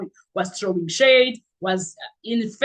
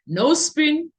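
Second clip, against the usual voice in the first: ordinary delivery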